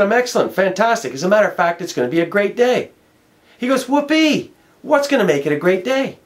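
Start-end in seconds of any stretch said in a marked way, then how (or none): 0.0-2.9 high, bright-eyed burst of enthusiasm; enthusiastic. Retold or imitated. Answering the man in the suit, gushing and excited. 3.9-6.2 high sarcastic whoopee; mock whoopee. The stranger teasing the upbeat speaker, mock cheer. Man mocks his enthusiasm.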